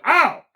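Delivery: high, loud mock "ow"; imitated pained cry. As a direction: yelp it high and loud, half laughing. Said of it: "And he goes".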